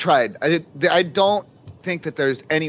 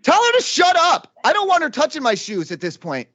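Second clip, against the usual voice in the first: panicked; 11 semitones higher than usual. Acted. Prank caller panicking about shoes.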